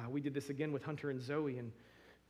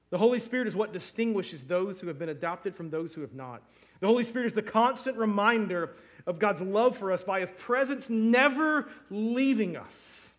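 preaching at full force; 6 semitones higher, 11 dB louder than usual. Acted. Pastor preaching to the church about the Spirit, loud and rising.

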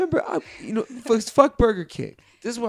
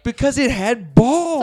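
emphatic; 4 semitones higher, 6 dB louder, slower than usual. Real, not acted. Insistent answer.

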